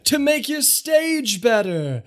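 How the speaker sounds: showman upstaging the critics; mocking showman. GM voices the showman taunting.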